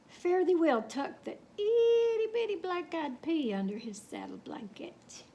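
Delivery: usual speaking voice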